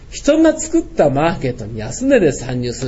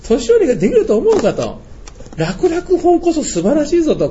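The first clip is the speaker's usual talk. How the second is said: 演説調の叱咤; 11 semitones higher than usual. Retold or imitated. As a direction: lecture them with mock outrage, pitched up. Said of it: "いつものように言ってやったのね". (高く大げさに叱りつける口調)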